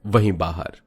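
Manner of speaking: ordinary delivery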